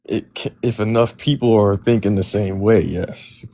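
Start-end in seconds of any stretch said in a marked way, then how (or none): none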